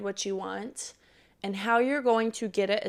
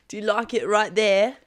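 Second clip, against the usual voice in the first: British lover; 9 dB louder than usual. Pretend. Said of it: "he's got the accent, he's British".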